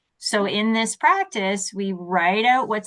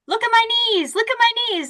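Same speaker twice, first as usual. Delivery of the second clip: put-on very high, squeaky kid voice; excited little child; 14 semitones higher, much faster than usual. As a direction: show off like a delighted small child. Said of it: "the little ones, they're like".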